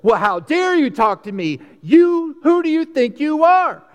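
high, loud, offended snap back; indignant retort. Pretend. Attacked person hits back, high loud indignation. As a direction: fire back like you feel attacked. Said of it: "feels attacked and goes".

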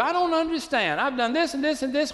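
high, pleading, anguished call; desperate cry out to God. Retold or imitated. Preacher voicing a believer crying out to God, high anguished plea. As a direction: cry out like a lost believer begging for answers. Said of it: "we just cry out".

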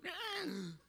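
high, strained comic gasp; mock gasp. Dad watching from heaven for the scare, mock gasp. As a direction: imitate a sudden high intake.

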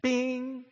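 high sung ding of a doorbell; doorbell imitation. Preacher mimics the doorbell ringing again, high chime.

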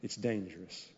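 dropped low and soft, "dangerous" almost breathed; hushed warning. Shown as a demonstration. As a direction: lean in and say it quietly. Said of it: "warning repeated later loudly".

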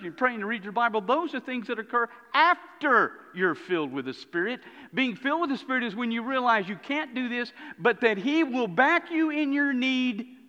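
loud, high, driving sermon delivery; full-force preaching. Real, not acted. Preacher urging the congregation about the Spirit, forceful and impassioned.

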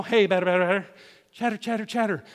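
Little League chant, softly; infield baseball chatter. Retold or imitated. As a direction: mimic the kids chanting from the field. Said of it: "You remember Little League Baseball?".

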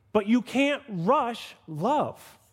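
slow right down to land the point; drawn-out preacherly emphasis; slow emphatic. Acted. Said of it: "preacher stresses point".

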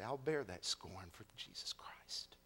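soft, low whisper; whispered vow. Acted. Believer answering mockers, whispered vow. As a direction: breathe the vow quietly.

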